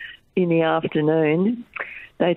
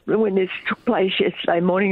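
usual speaking voice

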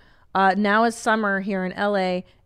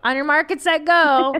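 higher, punching "go" louder; race starter call; 6 semitones higher, 4 dB louder than usual. Pretend. Starting the kids' stair race, playful starter call.

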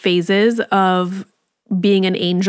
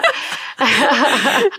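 short, high burst of laughter; real laugh; 5 semitones higher than usual. Real, not acted. Mom laughs with cohost over her parenting win. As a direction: laugh along with your cohost.

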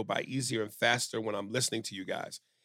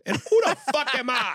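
high, loud indignant shout; mock outrage; 14 semitones higher, 7 dB louder than usual. Host objecting to praise of his co-host, mock-indignant shout.

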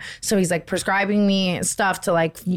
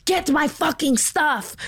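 high, drawn-out mock demand; indignant order, pitched up and slowed right down; retold angry order; 9 semitones higher, slower than usual. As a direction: imitate a bossy woman ordering people about. Retold demanding nurse snapping orders.

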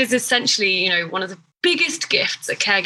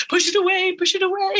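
higher mock brush-off, pushing feelings down; dismissive inner voice; 11 semitones higher than usual. Mocking how we tell ourselves to push feelings away, higher and dismissive. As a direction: shove it aside like an impatient inner voice. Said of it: "we're like".

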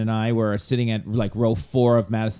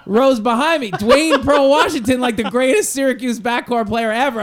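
much higher, louder, laughing retold outburst; excited fan; 12 semitones higher, 5 dB louder than usual. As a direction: gush like a starstruck fan.

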